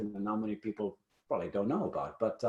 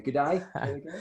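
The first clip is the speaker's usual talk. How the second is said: Australian g'day; 4 semitones higher than usual. Shown as a demonstration. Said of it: "say it again".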